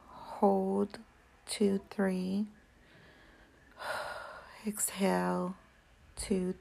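slow counted breathing cues; counting breaths. Shown as a demonstration. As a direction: count each breath in, hold, out. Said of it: "It goes like this".

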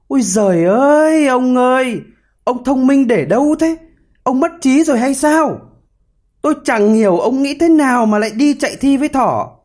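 high-pitched grumbling wife; scolding wail. A narrator's reading. Hedgehog's wife berating her foolish husband, scolding. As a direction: wail in exasperation, pitched well up. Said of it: "Vợ nhím càu nhàu la lối".

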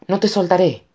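high, urgent cry back; grito. A narrator's reading. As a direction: shout your promise high. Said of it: "gritó Connor". (grito agudo y urgente)

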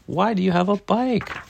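put-on high, curious little-kid question; curious child. A narrator's reading. Daniel asks Dad about the bike, high and curious. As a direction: ask dad with rising wonder.

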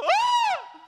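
loud high startled "ah"; freaked-out scream. Acted. Preacher showing how people react to bad news, panicked scream. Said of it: "Instead of freaking out".

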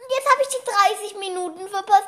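high, loud wail of dismay; real whining. Real, not acted. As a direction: whine it out high, upset at missing out. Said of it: "hör auf rumzuheulen".

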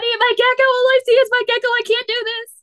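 high, loud, frantic protest; horrified refusal. Retold or imitated. To her date at dinner, a high loud panicked refusal. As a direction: shout it like the gecko's on the plate. Retelling refusing to eat alligator.

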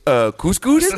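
Stedman. Acted. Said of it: "that's his catchphrase when he opens his door".

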